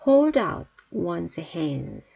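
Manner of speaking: very slow, each word drawn out; slow teacher demo; slow model reading. Shown as a demonstration. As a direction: spell it out slowly for learners. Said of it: "teacher models phrase slowly".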